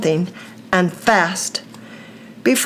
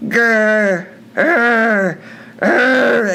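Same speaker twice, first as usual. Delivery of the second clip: angry monster growl; much slower than usual. A narrator's reading. The lake monster growling at the boys, angry growl. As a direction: growl like a furious lake beast. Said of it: "it growled angrily".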